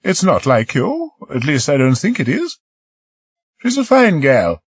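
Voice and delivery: a hint of Marie, coaxing; lightly voiced young woman. Marie coaxing Peter to come below, lightly voiced woman.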